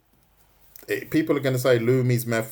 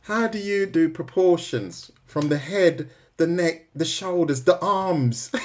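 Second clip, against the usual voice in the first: mock viewer question; 7 semitones higher, slower than usual. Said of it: "She just said to me".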